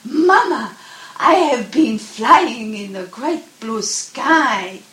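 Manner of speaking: little cub calling down to his mother, excited. A narrator's reading. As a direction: call out to mama, thrilled. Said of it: "and says".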